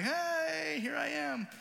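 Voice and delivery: put-on high, excited toddler voice showing off his fist; squeaky mock baby cheer. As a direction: play a proud little baby calling out. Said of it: "and be like".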